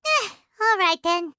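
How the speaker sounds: ordinary talk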